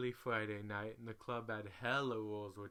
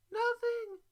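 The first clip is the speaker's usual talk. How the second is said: very high, loud shouted nothing; loud denial; 10 dB louder than usual. Retold or imitated. Two dancers hiding their earnings, shouting very high. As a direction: blurt it out together. Both answer in unison, denying.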